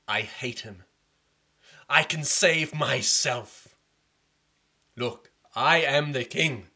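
jealous prince raging at his father; defiant prince. Acted. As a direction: insist on every word. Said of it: "prince rages".

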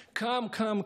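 usual speaking voice

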